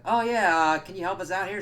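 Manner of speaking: high, loud put-on voice; imitated lady. Lady behind a door answers, high put-on voice. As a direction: raise the voice high and call out.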